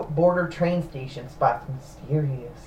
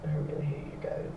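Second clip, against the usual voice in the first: whisper; 11 dB quieter than usual. Real, not acted. Groaning at friends' jokes.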